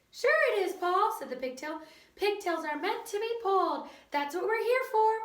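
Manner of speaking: high, persuasive little voice; pigtail voice. A narrator's reading. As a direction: sell it with a grin.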